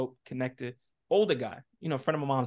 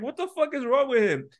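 high, louder worried scolding; mock angry mom; 10 semitones higher, 4 dB louder than usual. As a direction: demand an answer, pitched up. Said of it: "She's like".

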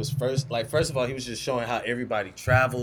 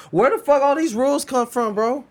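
exasperated outburst; 12 semitones higher, 8 dB louder than usual. Real, not acted. Outraged at rules.

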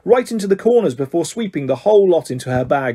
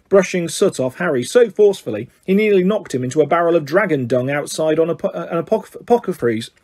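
plain reading voice

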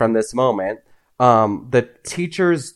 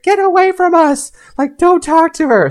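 high-pitched mock yell, imitating a panicked mother; retold alarmed mom; 4 dB louder than usual. Mom warning a stranger away from the kids, mock high yell. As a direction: warn someone off in a high, urgent voice.

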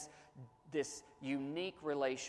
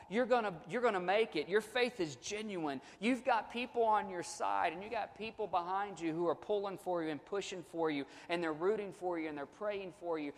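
higher, fervent reassurance; encouraging; 4 semitones higher than usual. Acted. Pastor voicing affirmation to someone going through hard times, higher. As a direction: cheer him on. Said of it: "words of affirmation like that".

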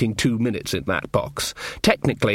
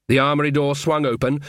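ordinary delivery, nothing marked

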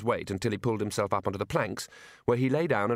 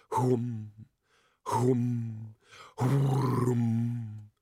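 mock whoom; much slower than usual. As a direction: hum like a whirling bull roarer. Imitating bull roarer noise.

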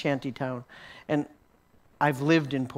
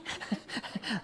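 giddy breathy laugh; 7 semitones higher, 10 dB quieter than usual. Retold or imitated. Young man laughing after touch.

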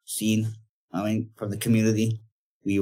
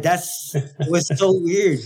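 laughing; 6 semitones higher, slower than usual. Retold or imitated. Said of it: "I'm like".

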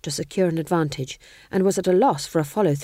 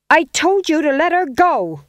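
higher, much louder furious yell; angry shout; 9 semitones higher, 8 dB louder than usual. Acted. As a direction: shout it, shaking with rage. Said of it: "bravery of the terminally angry".

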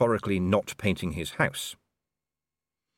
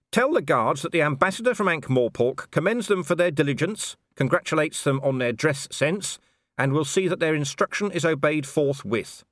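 high, forceful ambassador voice with sarcastic formality; Vimes, mock-official; 8 semitones higher, 3 dB louder than usual. A narrator's reading. Ambassador sends guards pointed praise through interpreter, mock-official proclamation. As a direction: proclaim it grandly, with an edge.

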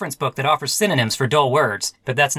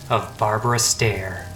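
hard-boiled narration. A narrator's reading. Detective narrating his past in a brooding noir voice-over. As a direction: brood over the memories like a tired gumshoe.

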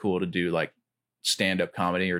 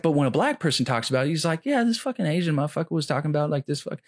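raised, fast mock rant; imitating Bill Burr; 7 semitones higher, faster than usual. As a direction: rattle it off high and quick like the comic. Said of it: "But he's like".

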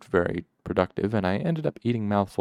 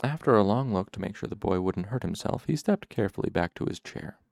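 ordinary delivery

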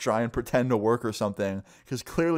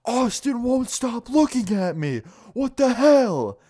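drawn-out, very high mock scream; retold scream; 13 semitones higher, 5 dB louder, slower than usual. Classmate screams out mid-lesson, hammed-up high scream. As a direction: imitate a furious kid screaming, slow and building louder. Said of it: "he screams out".

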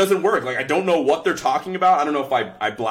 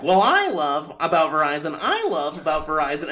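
lightly mocking, sing-song ad voice; imitating ad actors; 3 semitones higher than usual. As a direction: parody the back-and-forth of the commercial. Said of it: "they're like".

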